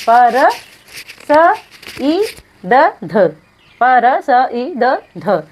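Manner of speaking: slow, letter by letter; spelled out slowly. Shown as a demonstration. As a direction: lay out each letter with a gap. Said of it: "spells out the letters slowly".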